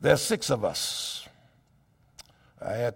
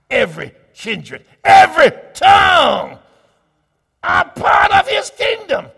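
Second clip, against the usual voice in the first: loud, rising preacher cadence that climbs high; fiery preaching; 10 semitones higher, 10 dB louder than usual. Acted. Preacher proclaiming to his congregation at full force.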